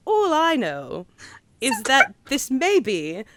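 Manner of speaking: hammed-up British accent with swelling emphasis; bad London accent. Host giving a verdict on the film, bad London accent. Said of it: "talk in a bad accent".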